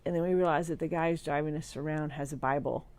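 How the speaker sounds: ordinary talk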